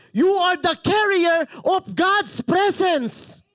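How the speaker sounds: high, slow, loud proclamation; impassioned preacher's roar; passionate shouting. Real, not acted. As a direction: shout it slowly to the whole church. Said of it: "why are you shouting, Pastor?".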